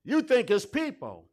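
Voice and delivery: loud, high, fervent preaching; preaching at full force. Acted. Preacher to congregation, full-force preaching. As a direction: lift the room with it.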